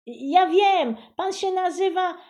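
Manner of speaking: put-on high, loud, eager child's cry; excited girl yelling out the answer; girl shouting. A narrator's reading. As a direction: shout over him like a pushy little girl. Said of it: "krzyknęła Buba".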